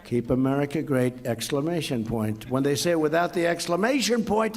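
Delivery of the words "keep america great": ordinary talk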